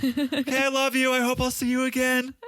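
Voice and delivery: very high, hammed-up tearful farewell; mock dramatic goodbye. Mocking Jenny's farewell before the zipline, very high and hammed-up. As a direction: overplay a melodramatic parting. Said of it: "So dramatic".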